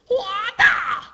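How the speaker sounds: high, loud martial arts yell; hammed-up attack shout; fighting cry. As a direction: let out a cartoon kung fu cry. Acted martial arts cry from manga.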